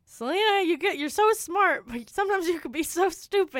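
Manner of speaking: imitating a scolding mom. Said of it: "My mom is constantly saying".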